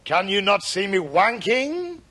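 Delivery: put-on slow, mispronounced French-accented question; cod French accent. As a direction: ask it in a mangled French accent. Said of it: "a man came on dressed as a Frenchman".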